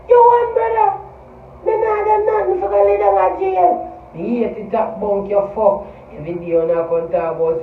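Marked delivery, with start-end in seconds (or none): 0.1-1.0 much higher, mocking "You won, brother"; sarcastic high-pitched jeer; mock taunt. Repeated taunt, second much higher. 1.6-3.7 mock voice. Mocking voice continues after taunt.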